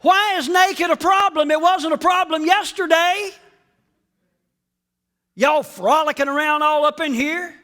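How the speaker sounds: high loud teasing voice; God teasing Adam. Acted. Preacher imagining God teasing Adam, high and loud. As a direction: tease him playfully. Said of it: "Can't you just hear God saying".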